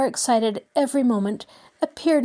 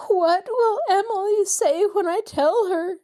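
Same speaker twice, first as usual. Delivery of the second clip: moaning; 11 semitones higher than usual. A narrator's reading. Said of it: "she moaned".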